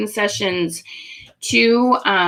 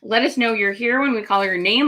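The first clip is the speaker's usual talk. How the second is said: ordinary talk, nothing marked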